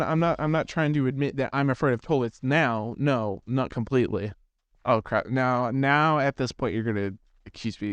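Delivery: ordinary talk, nothing marked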